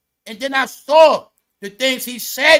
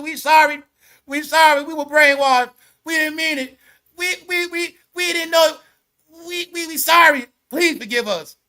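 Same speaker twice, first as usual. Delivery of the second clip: higher, louder pleading, stammering we, we; mock begging apology; 5 semitones higher, 4 dB louder than usual. Mocking liberals begging Trump to forgive them, pleading loudly. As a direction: ham up a sorry voter begging forgiveness. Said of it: "They begging Trump for forgiveness".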